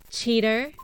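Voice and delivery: loud spat accusation; accusing shout. Acted. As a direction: hurl the word like an indictment.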